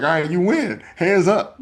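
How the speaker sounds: high, laughing give-up; mock surrender. Kid giving in to mom so she stops pulling teeth. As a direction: throw your hands up and concede.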